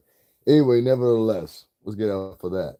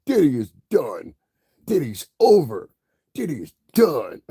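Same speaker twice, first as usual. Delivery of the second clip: mocking YouTuber intro; 7 semitones higher, 4 dB louder than usual. Mocking YouTubers' openers, loud and high. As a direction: announce it like a hyped gossip host. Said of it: "All of them start this shit".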